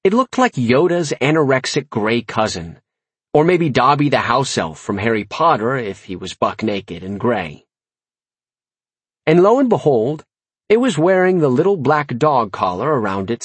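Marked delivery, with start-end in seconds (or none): none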